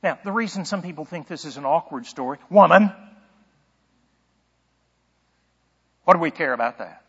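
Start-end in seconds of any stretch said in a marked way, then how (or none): 2.5-7.0 loud, abrupt "woman", then a cold rejoinder; curt, cold brush-off; stern, cold. Acted. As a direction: deliver it as a stern rebuke. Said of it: "a stern, cold rejoinder".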